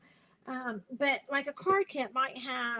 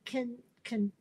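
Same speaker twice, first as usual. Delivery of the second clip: ordinary delivery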